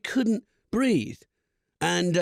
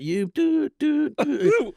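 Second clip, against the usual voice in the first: beep machine imitation; 9 semitones higher, much slower than usual. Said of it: "it would be like".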